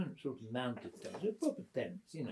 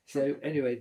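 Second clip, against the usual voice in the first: ordinary talk, nothing marked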